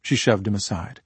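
ordinary delivery

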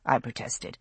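ordinary delivery

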